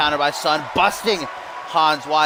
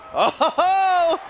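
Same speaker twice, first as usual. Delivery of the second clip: excited shout; 11 semitones higher than usual. Real, not acted. Surprise super kick called live.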